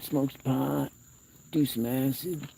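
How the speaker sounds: high-pitched partying buddy voice; mock party animal. Man voicing raccoons out to party, high mock party voice. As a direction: put on a high, rowdy partier rallying the gang.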